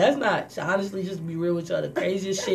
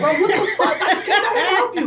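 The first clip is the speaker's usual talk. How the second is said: much higher, louder, laughing indignation; mock outrage; 12 semitones higher, 6 dB louder than usual. Girlfriend attacking with scooter, high laughing mock outrage. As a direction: protest in shocked disbelief.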